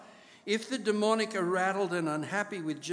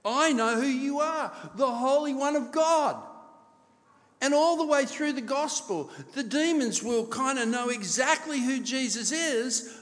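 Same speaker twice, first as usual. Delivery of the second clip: higher and louder sermon emphasis; rising preaching; 7 semitones higher, 4 dB louder than usual. Acted. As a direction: lift the congregation's attention. Preacher rises in pitch, louder.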